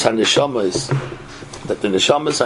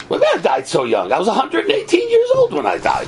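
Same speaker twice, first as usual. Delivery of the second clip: indignant protest; 12 semitones higher, faster than usual. Acted. Dead man protests his age.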